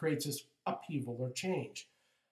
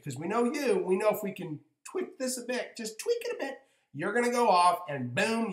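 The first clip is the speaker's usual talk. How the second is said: higher, louder, brisk guides planning a nudge; spirit guides talking; 5 semitones higher, 6 dB louder than usual. Retold or imitated. Spirit guides telling John they'll push him on, higher and louder. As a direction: play the guides plotting cheerfully.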